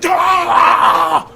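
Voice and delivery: high, loud wordless roar of fury; raging yell. Acted. Prisoner gripping the cage bars, raging roar. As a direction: bellow like a trapped beast. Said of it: "just goes".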